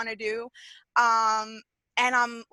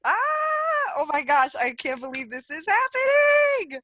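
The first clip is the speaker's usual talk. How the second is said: high, loud squeal of excitement; real excitement; 9 semitones higher, 4 dB louder than usual. Real, not acted. Caller finally gets through to the host, squealing with excitement. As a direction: shriek it in disbelief, rising to a shout. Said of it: "I'm so excited".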